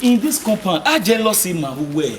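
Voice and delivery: loud protest. Acted. Suitor loudly arguing his case to the woman next door. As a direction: shout it over her.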